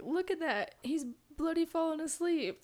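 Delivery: high, loud mock British accent; Michael Caine. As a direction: do a hammed-up Cockney British voice. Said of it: "But, like, in his cool voice".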